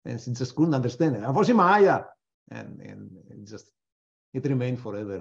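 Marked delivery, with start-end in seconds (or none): 1.2-2.0 put-on "Vozi Maya" loud and high in a German accent. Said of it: "my German accent".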